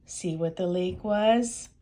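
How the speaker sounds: ordinary delivery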